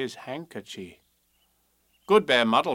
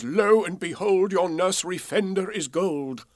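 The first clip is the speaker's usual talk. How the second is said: magic spell; 6 semitones higher, 5 dB louder than usual. A narrator's reading. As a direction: proclaim it like a wizard casting a spell. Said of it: "a wonderful spell which says".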